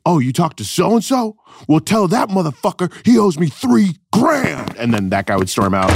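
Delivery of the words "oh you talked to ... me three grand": irate hipster. Retold or imitated. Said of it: "they'd be like".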